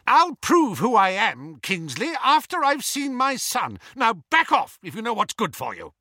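high, forceful shout of a frantic father; angry shout. A narrator's reading. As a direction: shout them out of your way. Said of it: "never heard Mister Weasley shout like that before".

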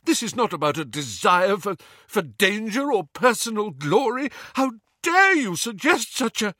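high, indignant outburst; livid. A narrator's reading. Lupin defending himself to Harry, livid. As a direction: protest furiously, pitch climbing. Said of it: "Lupin's livid face".

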